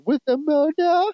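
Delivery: high, drawn-out mock French accent; attempted French accent. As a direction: stretch it out in a hammy French accent. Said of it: "I can't do her accent".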